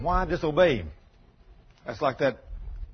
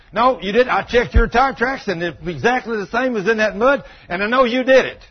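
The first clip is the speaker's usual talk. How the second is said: loud and higher, furious insistence; angry insisting; 7 semitones higher, 8 dB louder than usual. Retold or imitated. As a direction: shout him down, sure he's right.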